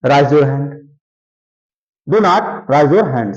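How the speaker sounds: ordinary delivery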